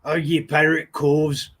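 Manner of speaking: lightly voiced growly-free hammed pirate. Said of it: "they didn't talk like that".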